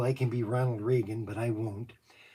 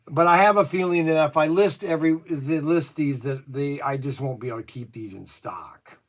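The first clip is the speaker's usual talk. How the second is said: normal talking voice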